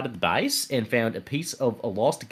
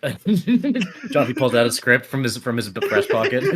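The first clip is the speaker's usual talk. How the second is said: laughing; 4 semitones higher, 6 dB louder than usual. Real, not acted. Laughing while narrating.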